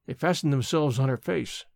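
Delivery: ordinary delivery, nothing marked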